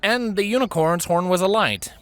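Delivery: plain reading voice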